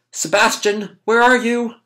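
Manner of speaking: put-on high-pitched boy calling out; acted searching call. Boy searching the house for Sebastian, searching call. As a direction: call out as a lost child would.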